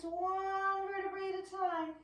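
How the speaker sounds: slow, high, sing-song coaxing. Shown as a demonstration. Teacher coaxing kids to roll up slowly. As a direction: stretch it out so they slow down. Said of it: "take your time".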